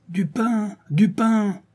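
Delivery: very high chanted cries for bread; mob yelling. A narrator's reading. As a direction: yell it as a whole rioting band. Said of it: "hurla sa troupe". (hurlements de la troupe)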